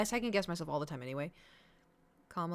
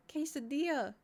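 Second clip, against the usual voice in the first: ordinary talk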